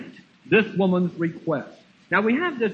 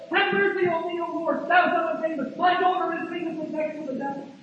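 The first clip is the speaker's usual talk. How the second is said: high repeated plea, easing in volume; crying out; 9 semitones higher than usual. Acted. Woman following Jesus down the street, crying out. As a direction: call after him high, fading into the distance. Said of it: "here she is crying right after him".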